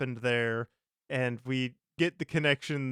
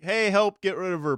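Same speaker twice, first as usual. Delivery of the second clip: mock yell for help, raised and higher; retold yell; 6 semitones higher, 5 dB louder than usual. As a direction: call out for help, half in earnest. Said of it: "she starts yelling like".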